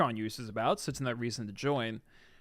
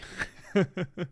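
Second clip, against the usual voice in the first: high quick chuckle; brief burst of giggles; short laugh; 4 semitones higher than usual. Real, not acted. Streamer amused about Fred the movie, short high chuckle.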